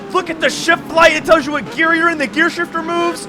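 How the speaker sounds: high-pitched, loud amazement; excited discovery. Real, not acted. Gamer spotting moving pedals and shifter, excited outburst. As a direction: call out each find, rising to a shout.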